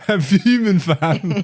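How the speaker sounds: mock London roadman, light; lightly voiced London roadman; a hint of a London roadman accent. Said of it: "Top Boy shit".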